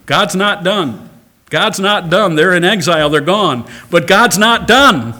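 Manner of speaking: full-force preaching, climbing with every repeat; building sermon cry. Real, not acted.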